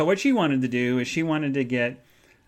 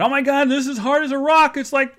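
much higher, louder dismayed complaint; friend complaining; 12 semitones higher, 7 dB louder than usual. Retold or imitated. Retelling her friend's reaction to the hard bed, high dismayed voice. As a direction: play the friend lying on a rock-hard bed. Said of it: "she said".

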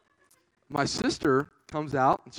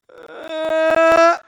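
loud high crying wail; sibling wail; 12 semitones higher, 15 dB louder than usual. Retold or imitated. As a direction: imitate the delayed cry. Sister's cry sound.

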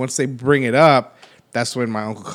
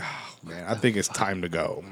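much softer, lower murmur to a brother; quiet aside; 5 semitones lower, 10 dB quieter than usual. Retold or imitated. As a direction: slip it out quietly so nobody hears.

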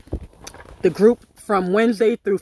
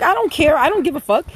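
defiant rant; 6 semitones higher than usual. Retold or imitated. Kid mouthing off to the teacher, high and heated. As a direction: snap back at the teacher with rising heat. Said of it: "I'm like".

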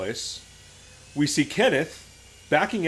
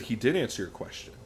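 trauma-informed social worker; 3 semitones lower, 7 dB quieter than usual. Retold or imitated. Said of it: "full trauma-informed social worker".

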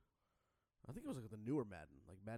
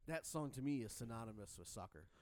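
ordinary talk

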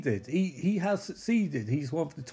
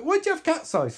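mock surprised question; 13 semitones higher than usual. [name] asks in comic retelling.

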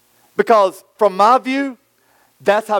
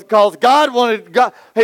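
ordinary talk, nothing marked

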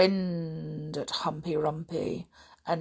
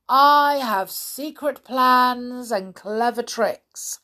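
slow, loud, higher-pitched boast; crafty crocodile, savouring the secret; scheming; 6 semitones higher, 6 dB louder, slower than usual. Acted. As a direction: stretch it out with sly pride. Crocodile hints at plot.

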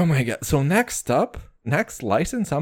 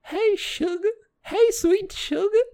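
high, slow Southern "Hey, sugar" with sugar as "shuga"; sweet-talking Southern voice, "sugar" said "shuga"; Southern drawl. Retold or imitated. Mocking how Southerners say sugar, in a Southern accent. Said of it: "how they pronounce sugar".

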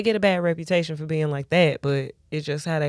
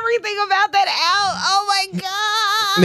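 excited cry; 14 semitones higher than usual. Real, not acted. Excited about [name].

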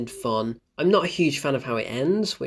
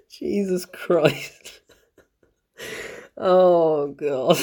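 laughing exasperation; 3 semitones higher, much slower than usual. Real, not acted. Reacting to the terrible line.

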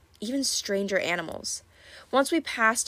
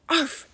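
barked greeting; 8 semitones higher than usual. Acted. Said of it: "Grover told it".

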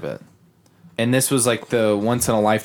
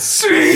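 whooshing brush streak; 5 dB louder than usual. Retold or imitated.

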